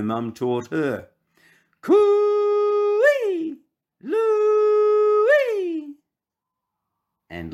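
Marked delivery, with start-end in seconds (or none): 1.8-6.0 high, long hollering call; calling out coo-ee. Acted. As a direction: call out far across the yard. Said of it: "she called to him across the yard".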